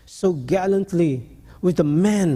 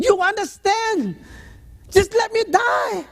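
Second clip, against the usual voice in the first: despairing patient. Retold or imitated. Patient wailing at psychologist, very high and slow. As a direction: plead to be let die.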